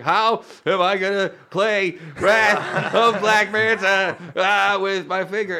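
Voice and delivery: high, loud, slow whimpering plea; hammed-up tearful whine; mock whiny kid. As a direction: wail it like a crying child. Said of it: "I was like".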